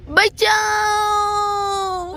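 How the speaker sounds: long drawn-out cry for help; terrified scream. Acted. Suhana pulled into the box, screaming for help. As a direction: scream as you're dragged away.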